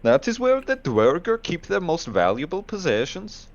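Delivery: high, swooping put-on voice; dwarf lore in character. Player voicing where dwarfs keep valuables, in-character lilt.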